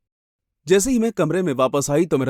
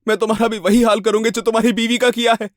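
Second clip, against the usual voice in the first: high, forceful yell; shouted warning; 7 semitones higher, 4 dB louder than usual. A narrator's reading. Woman warning the hidden husband off, shouting high and urgent. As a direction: shout the threat into the dark. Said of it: "फिर मैंने चिल्लाकर कहा".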